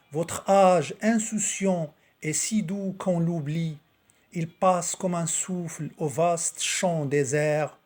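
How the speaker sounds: slow, projected poetry reading; poem recitation. Acted. Reading Victor Hugo's poem to video viewers, slow declaimed recitation.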